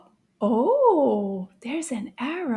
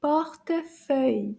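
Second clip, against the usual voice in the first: usual speaking voice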